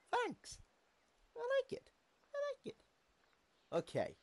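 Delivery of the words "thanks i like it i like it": squeaky high voice. Pretend. Squeaky silly thanks.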